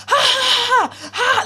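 high, mocking fake laugh; loud sarcastic "ha, ha" pitched high; parody laughter. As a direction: imitate a crowd jeering with fake laughs. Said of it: "a parody of laughter".

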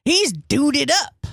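slow mock saying; sister's phrase. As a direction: drawl it like the sister. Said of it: "as my sister would say".

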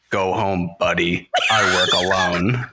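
low, gruff mock hero line; mock tough guy. Logan Paul brushing off the fan, low mock tough-guy voice. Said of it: "really looked at him and said".

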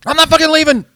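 high, loud yelled declaration; shouted quote. Retold or imitated. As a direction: belt it out like a victory cry. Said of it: "quoting a famous call".

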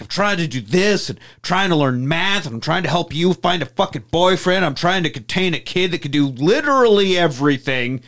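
high, frantic rant; frazzled dad snapping at his kids. Retold or imitated. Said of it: "snaps and goes off on a rant".

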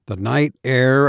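ordinary delivery, nothing marked

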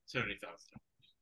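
ordinary delivery

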